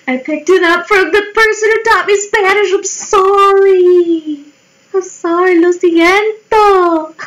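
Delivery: squealed apology. Pretend. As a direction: plead sorry in a high squeal. Over-the-top apology for the accent.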